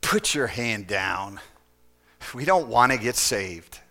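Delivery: low, slow, soft muttered warning; a low hushed aside under the breath. Retold or imitated. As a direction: pull the voice down low and hush it like a friend cutting in. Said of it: "He said".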